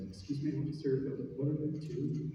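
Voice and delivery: soft, meek question to an officer; small, hesitant, hushed voice; timid. Retold or imitated. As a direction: imitate working up the nerve to speak, very quietly. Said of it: "finally get the courage to speak up".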